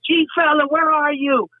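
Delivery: high, imitated stage call. Star summoning G fella to the stage, retold as a high call-out. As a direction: call someone up from the crowd, mimicked.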